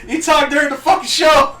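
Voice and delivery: loud, high accusing shout; laughing accusation. Real, not acted. Friend accusing her of talking through the show, loud. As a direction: call her out loudly, laughing. Mock scolding.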